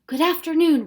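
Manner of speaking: loud, high greeting; shouting up. Acted. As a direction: call up to her. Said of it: "the professor shouted up at her".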